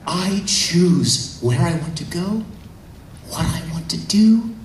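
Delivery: a hint of a girl asserting herself; acted declaration. Android girl claiming her choice, lightly acted emotional resolve.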